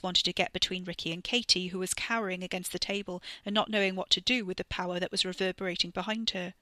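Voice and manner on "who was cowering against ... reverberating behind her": plain reading voice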